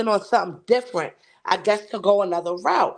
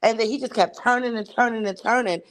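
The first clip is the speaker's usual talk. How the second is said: normal talking voice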